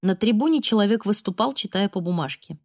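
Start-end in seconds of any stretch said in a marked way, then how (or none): none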